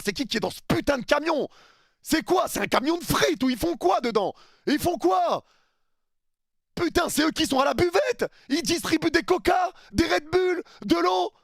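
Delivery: high, loud outraged mockery of the VAR truck; mocking rant. Fan mocks VAR referees hiding in their truck, shrill loud jeering.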